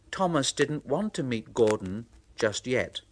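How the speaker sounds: ordinary delivery, nothing marked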